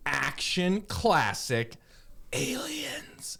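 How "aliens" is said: breathy whispered movie-trailer reveal; horror trailer voice. Acted. Dramatic movie title reveal.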